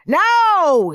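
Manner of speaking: high, loud scream. A narrator's reading. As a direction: bellow the word, high. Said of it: "screamed Mister Nurden".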